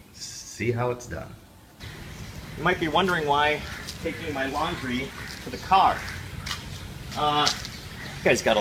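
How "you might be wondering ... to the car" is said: ordinary talk, nothing marked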